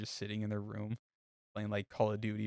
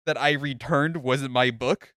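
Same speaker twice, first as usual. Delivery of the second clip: slow, louder, disbelieving question; indignant disbelief; 4 semitones higher, 10 dB louder, slower than usual. Retold or imitated. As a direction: stretch it out like you can't believe it. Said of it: "it's like".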